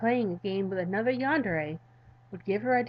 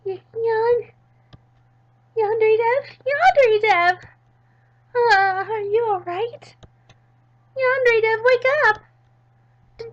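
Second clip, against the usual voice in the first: put-on high, loud, pleading girl calling out; worried girl voice; 13 semitones higher, 11 dB louder, slower than usual. Midori begging fainted Yandere Dev to wake up, high and loud. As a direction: shake a fainted friend awake in a high voice.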